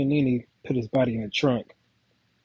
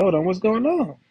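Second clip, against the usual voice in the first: alarmed; 8 semitones higher, 4 dB louder than usual. Retold or imitated. Girlfriend thrown down, about killed.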